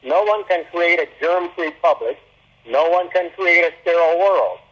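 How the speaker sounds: ordinary talk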